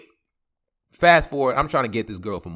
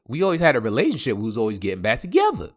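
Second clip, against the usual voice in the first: ordinary talk, nothing marked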